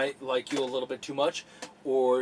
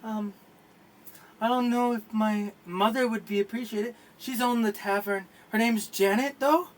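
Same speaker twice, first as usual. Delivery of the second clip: barmaid agreeing; 6 semitones higher than usual. Acted. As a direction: accept shyly and sit down. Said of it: "She says".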